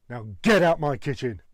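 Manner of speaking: mildly angry dismissal; loud punch on the order; Gordon Ramsay. Acted. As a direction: throw them out, annoyed. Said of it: "sound angry and annoyed".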